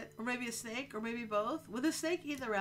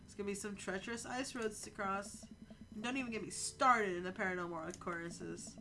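normal talking voice

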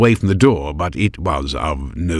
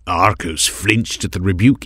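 usual speaking voice

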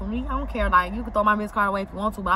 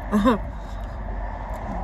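quiet breathy chuckle; soft breathy laugh; 4 dB quieter than usual. Real, not acted. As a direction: giggle under the breath. Laughing at own story.

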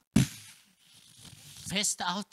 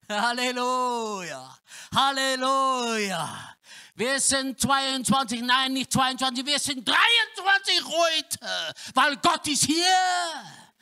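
higher and louder, shouted preaching; full-force preaching; 8 semitones higher, 7 dB louder than usual. Acted. Preacher proclaims God present, full-force preaching. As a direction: proclaim it to the whole room.

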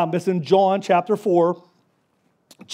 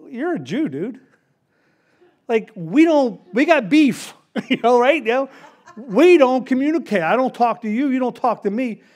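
a touch of mock sass, pitched up; tossing it back at him with a bit of attitude; snarky; 5 semitones higher than usual. Samaritan woman brushing off Jesus at the well, mildly snarky. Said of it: "I don't know if she's getting all snarky with him".

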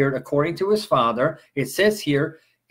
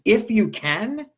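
"If you can" raised high as a question; 5 semitones higher, slower than usual. Retold or imitated. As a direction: challenge "If you can" back, drawn out and rising. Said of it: "question mark".

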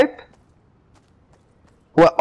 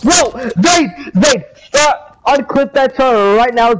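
higher-pitched, insistent call to stop; urgent demand; 5 semitones higher, slower than usual. Real, not acted. Telling a friend to stop clipping, urgent and raised. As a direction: order a friend to quit it at once.

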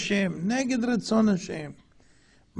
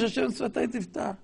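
mock humble prayer; 8 dB quieter than usual. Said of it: "עכשיו הוא מבקש".

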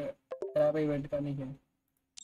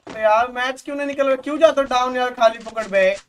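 frustrated outburst; 5 semitones higher, 8 dB louder than usual. Real, not acted. Frustrated at match and teammate.

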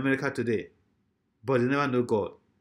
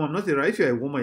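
normal talking voice

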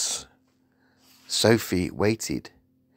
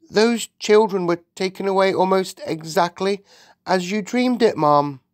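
high, earnest servant voice; agitated maid; 10 semitones higher, 4 dB louder than usual. Acted. The maid explains the dream came true, agitated. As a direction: insist anxiously on the coincidence.

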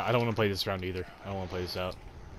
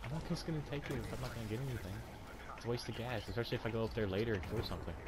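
hushed; 10 dB quieter, faster than usual. Real, not acted. Talking low mid-game.

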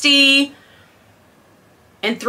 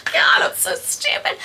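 real laughter through the words; giggling helplessly at her own mistake; cracking up over bad math; laughing at herself; 6 dB quieter than usual. Real, not acted.

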